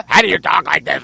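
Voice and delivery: low, garbled mocking growl; mocking his radio voice. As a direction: imitate his awful voice, pitched low. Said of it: "talk like this on the fucking radio".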